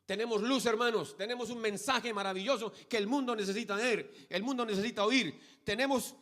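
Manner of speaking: high, loud, impassioned pulpit voice; full-force preaching. Real, not acted. Preacher rousing the congregation and its young people, full-force preaching. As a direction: proclaim it at full volume, drawing out key words.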